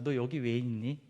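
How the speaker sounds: a low, soft question asked kindly; gentle God. Acted. The preacher voicing God asking Elijah softly, gentle and low. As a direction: ask it tenderly, like a father.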